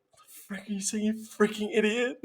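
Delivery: high, hushed inner voice cursing. Retold or imitated. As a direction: mutter the insult inside your head. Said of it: "Like, in my head".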